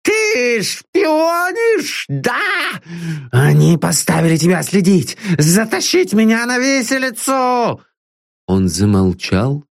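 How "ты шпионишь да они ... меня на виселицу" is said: put-on a frightened old man seeing spies everywhere; raving old man. Master accuses his servant of spying for the gallows, raving.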